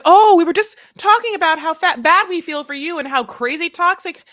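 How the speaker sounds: high, loud catty gossip voice; mock gossip. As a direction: gush fake sympathy, catty underneath.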